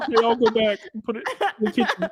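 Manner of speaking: a giggling, cartoonish waiter; a waiter laughing while he answers the angry executive; mock waiter voice. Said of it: "the waiter's like".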